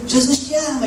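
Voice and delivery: high street-evangelist yell; retold shout. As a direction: shout it to a passing stranger.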